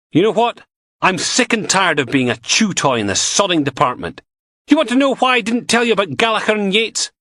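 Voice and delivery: angry outburst. Acted. Logan fed up, shouting.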